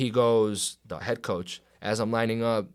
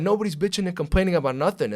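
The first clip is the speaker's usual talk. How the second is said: loud, higher re-enacted denial; retold angry protest; 8 semitones higher, 6 dB louder than usual. Player denies complaining before team, retold angry protest. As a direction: protest it for everyone to hear.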